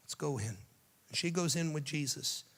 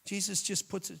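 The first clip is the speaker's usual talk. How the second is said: ordinary delivery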